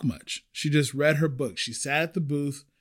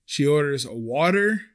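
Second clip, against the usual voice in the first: normal talking voice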